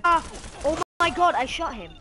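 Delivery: loud, high outburst; exasperated protest. Real, not acted. Gamer insisting the shot landed, exasperated shout. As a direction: cry foul at full voice.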